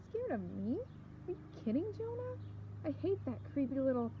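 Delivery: indignant, pitch leaping up on the questions; angry outburst. A narrator's reading. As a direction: protest hotly without truly yelling. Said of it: "I shouldn't be yelling at him".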